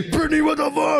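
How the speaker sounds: high, laughing panicked cry; half-laughing startled outcry; waking in pain, calling to Brittany, high and laughing; panicked yelp. Retold or imitated. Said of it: "dreaming, hitting himself".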